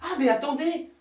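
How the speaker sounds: ordinary talk, nothing marked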